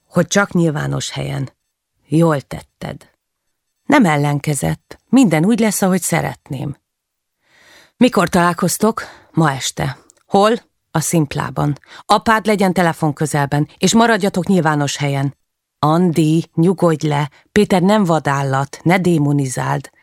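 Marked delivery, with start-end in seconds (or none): none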